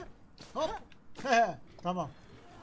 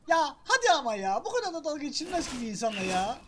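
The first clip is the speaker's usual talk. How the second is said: loud, fast frustrated rant; annoyed outburst; exasperated complaint; 4 semitones higher, 6 dB louder than usual. Real, not acted. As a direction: vent at the unfair game. Complaining about being mocked.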